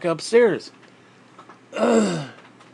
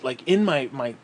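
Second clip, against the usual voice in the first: ordinary talk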